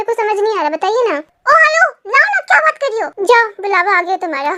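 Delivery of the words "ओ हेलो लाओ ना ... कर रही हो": high-pitched, impatient nagging; impatient demand. Acted. Impatient demand to hand it over.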